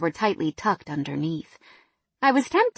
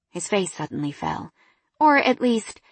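plain reading voice

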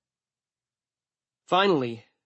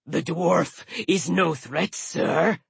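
reassuring dragon; 3 semitones higher, slower than usual. A narrator's reading. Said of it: "the dragon assured himself".

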